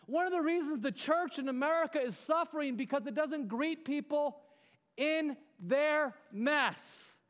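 high-pitched, urgent sermon delivery; impassioned preaching. Real, not acted. Preacher telling church to greet people in their mess, impassioned. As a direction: drive the point home to the congregation.

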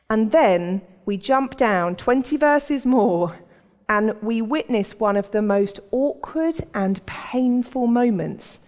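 normal talking voice

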